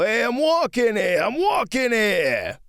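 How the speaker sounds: high, loud mock New York holler; New Yorker. Telling travelers not to act like New York, mock New York yell. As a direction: holler it like a brash New York pedestrian, stretching the words. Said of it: "It's not New York. You don't go".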